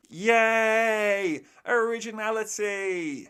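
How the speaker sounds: loud, high mock celebration; sarcastic cheer.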